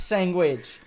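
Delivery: "Sandwich" said as "sangwich", high and loud; playful New York "Sandwich" with ng-g in the middle; mock New York pronunciation. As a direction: echo "Sandwich" with a hard G, sangwich. Echoing the mock accent word.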